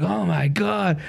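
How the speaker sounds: high-pitched retold "oh my God"; retold exclamation. Storyteller recalls standing back up, high exclaiming. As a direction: act out a high exclamation after a spill. Retelling getting up after accident.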